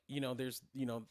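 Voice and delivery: normal talking voice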